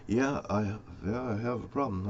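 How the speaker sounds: a hushed, intimidated reply; meek reply. Retold or imitated. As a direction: answer the big guy softly and meekly. Meek reply to a threatening visitor.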